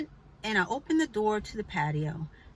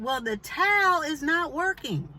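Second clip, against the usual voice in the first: raised-pitch, emphatic reply; more emphasis; 7 semitones higher, 3 dB louder than usual. Retold or imitated. Answering the neighbor's towel excuse, emphatic and high. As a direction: press the point, pitched high but composed. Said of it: "with a little bit more emphasis".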